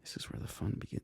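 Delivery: breathy hushed movie quote; whispered Anakin quote. Retold or imitated. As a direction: hush it like a secret. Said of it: "epic Anakin quote".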